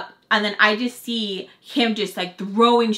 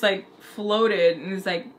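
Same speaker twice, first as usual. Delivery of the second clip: normal talking voice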